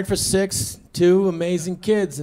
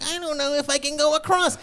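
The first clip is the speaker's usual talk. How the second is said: put-on timid toddler; 7 semitones higher, 3 dB quieter than usual. Voicing timid toddler son.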